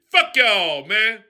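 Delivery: normal talking voice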